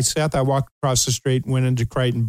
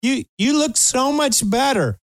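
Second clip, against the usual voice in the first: amused wife; 10 semitones higher than usual. Retold or imitated. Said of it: "My wife's laughing".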